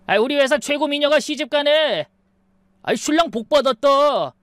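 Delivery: high-pitched, hearty coworker congratulating; 축하하는 직장 동료. A narrator's reading. Coworkers loudly congratulate the bride. (높은 톤으로 너스레 떨며 축하하는 동료)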